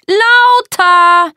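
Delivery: very high, loud drawn-out yell; shouted order. Acted. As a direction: yell the command furiously. Er brüllt den Befehl. (wütend gebrüllt)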